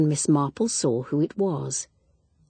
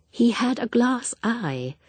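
put-on higher, slow, gentle old lady explaining; explanatory; 5 semitones higher than usual. A narrator's reading. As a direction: patiently set her right. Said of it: "in an explanatory voice".

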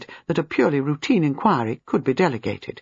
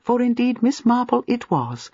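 ordinary delivery

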